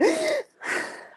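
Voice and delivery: a high sudden gasp of cold shock; sharp gasp. Shown as a demonstration. Teacher demonstrating the cold-shower shock with a gasp. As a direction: gasp as if icy water just hit you.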